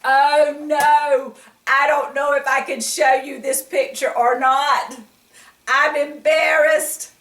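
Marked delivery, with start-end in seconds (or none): none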